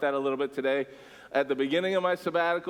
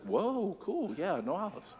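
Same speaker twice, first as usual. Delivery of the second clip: taken aback; 6 semitones higher, 4 dB quieter than usual. Retold or imitated. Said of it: "Duck's like".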